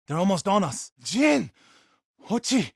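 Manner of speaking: loud, high warning shouts; alarmed battle calls; urgent calling out. Acted. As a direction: call out names in alarm.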